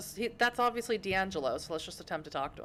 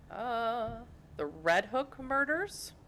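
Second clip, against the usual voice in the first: unsure question; 5 semitones higher, much slower than usual. Pretend.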